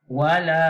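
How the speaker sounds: Wala with the la held long and loud; long vowel. Shown as a demonstration. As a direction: stretch the la of Wala. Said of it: "Dia dibaca panjang". (Wala dibaca panjang)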